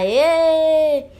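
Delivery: loud, high, drawn-out hurray; celebratory cheer. Pretend. Teacher celebrating School Day with pupils, loud happy cheer. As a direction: cheer it out for the children.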